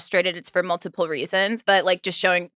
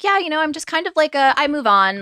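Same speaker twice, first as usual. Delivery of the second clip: high, louder, mock carefree brush-off; mock breezy; 9 semitones higher, 4 dB louder than usual. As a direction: shrug it all off like nothing happened. Mocking Brynn's carefree morning attitude.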